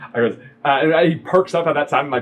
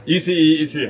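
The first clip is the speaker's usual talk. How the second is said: ordinary talk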